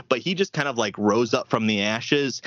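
normal talking voice

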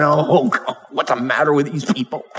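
low, muted grumble of imagined exasperation; mock exasperation. Preacher imagines an onlooker grumbling at graveside mourners, mock exasperated. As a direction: grumble it aside, as if fed up. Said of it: "Jesus is not standing over to the side of the graveyard saying".

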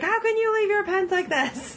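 high-pitched horrified tidy-freak voice; appalled neat freak. Retold or imitated. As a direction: squeal it like the pens physically hurt you. OCD Farrah flipping out over pens.

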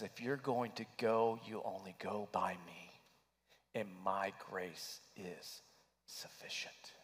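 soft whisper. Retold or imitated. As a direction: let Jesus' words fade to a hush. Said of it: "He whispered".

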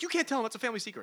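father protesting a family secret; shocked protest. Retold or imitated. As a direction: squeal it in alarm. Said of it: "he's like".

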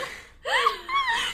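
laughing out loud, high; a sudden high laugh; bursting out laughing at her quick yes; high burst of laughter. Real, not acted. Laughing at friend's quick answer.

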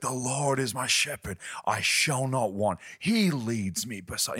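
showy powerful preaching. Retold or imitated. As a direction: perform it like a young star preacher showing off. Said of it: "he's kind of been very powerful".

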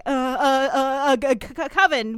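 stuttering, scrambling for an answer; mock flustered stammer. Host imitating writers hiding a Halloween episode, mock flustered. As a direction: stall on repeated syllables, then blurt the word. Acting out writers fumbling for a title.

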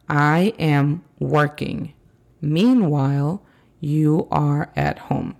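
slow, careful teacher reading; slow model sentence. Shown as a demonstration. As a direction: spell it out slowly for learners. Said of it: "teacher repeats example slowly".

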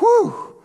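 high-pitched exhaled "whew"; relieved whew. Retold or imitated. Survivor safe by the fire after the shipwreck, relieved whew.

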